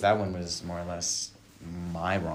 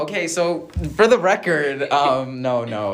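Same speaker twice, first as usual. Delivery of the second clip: loud; 8 semitones higher, 13 dB louder than usual. Acted. Loud joking host correction.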